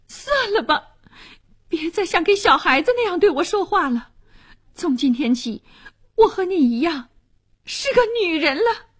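put-on high-pitched, indignant young woman; defiant. A narrator's reading. Niece refuses to be treated as a child, defiant. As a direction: throw it back in high-voiced defiance. Girl defies her scolding aunt.

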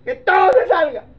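high, loud shout with a laugh in it; shouted order. Acted. Joseph sending everyone out, loud laughing shout. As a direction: bark it out half laughing.

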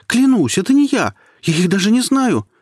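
high, loud desperate denial; frightened pleading shout. A narrator's reading. Scared boy swears innocence, high frightened shout. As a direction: beg her to believe you. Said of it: "закричал напуганный мальчишка".

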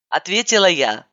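usual speaking voice

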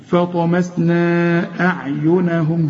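"فطمسنا" with final vowel held long and level into "أعينهم"; slow recitation, stretch "نا" in "فطمسنا"; long madd. Shown as a demonstration. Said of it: "ومد أربع حركات".

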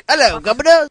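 high, bright-eyed British put-on greeting; mock British accent. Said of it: "when I do the British accent".

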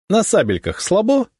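high-pitched taunting dare; cocky bravado. A narrator's reading. Donets daring the sotnik to a sabre bout, cocky. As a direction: throw down a swaggering challenge. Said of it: "хорохорился Донец".

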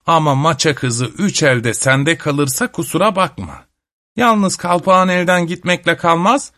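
a hint of a witch laying down terms; mildly barking witch. A narrator's reading. Witch warns grandfather about the card game's stakes, lightly barking. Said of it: "Cadı karısı bir kez daha havladı".

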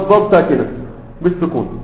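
usual speaking voice

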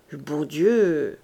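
slow, low, dazed echo; mechanical repetition. A narrator's reading. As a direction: repeat it absently, drawn out and low. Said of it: "répéta machinalement". (répétition machinale, lente et hébétée)

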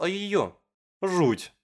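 ordinary delivery, nothing marked

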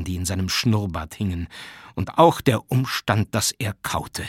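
usual speaking voice

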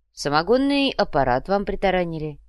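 ordinary delivery

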